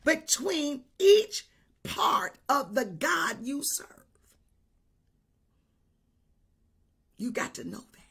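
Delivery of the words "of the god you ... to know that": breathy low whisper; whispered aside. Real, not acted. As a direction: lean in and whisper it to the congregation. Preacher drops to intimate whisper.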